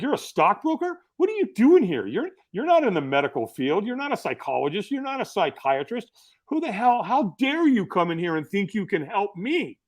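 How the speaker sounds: mock outrage, high-pitched questions; pissed-off Doctor Bob. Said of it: "Doctor Bob was pissed off".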